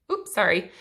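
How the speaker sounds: ordinary delivery, nothing marked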